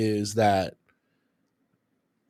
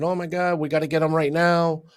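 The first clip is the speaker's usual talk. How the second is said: mock panic; 7 semitones higher than usual. Mock worried colleagues.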